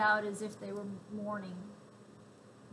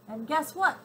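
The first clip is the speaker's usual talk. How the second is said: louder, higher, eager blurt; loud exclaim; 6 semitones higher, 7 dB louder than usual. A narrator's reading. Little girl calling out to the soldier, loud blurt. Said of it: "exclaimed suddenly in a loud voice".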